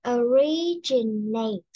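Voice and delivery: very slow, each of the four syllables of Originate spelled out; slow syllable demo. Shown as a demonstration. Teacher modelling a word slowly for the class. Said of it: "Bốn âm tiết ha".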